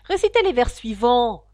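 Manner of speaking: loud impatient repeat; impatient griffon, loud command. Acted. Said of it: "répéta le griffon avec impatience".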